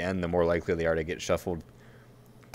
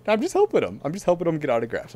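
squeaky, much higher mock-innocent voice, a touch louder; protesting sweetly in a pitched-up voice; high innocent reply; 10 semitones higher, 5 dB louder than usual. Telling the fans she is only helping, high and mock-innocent.

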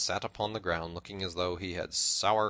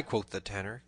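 usual speaking voice

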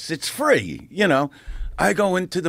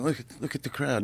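hushed aside; 9 dB quieter, much faster than usual. Retold or imitated. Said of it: "I'm looking at my friend going".

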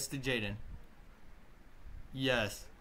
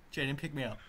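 ordinary talk

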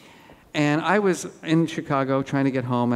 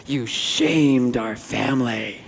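slow, low, heavy disapproving brother; a hint of a solemn older brother passing judgment; brother's verdict; 3 semitones lower, much slower than usual. Brother scolding the speaker after the talk, slow and grave. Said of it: "and he goes".

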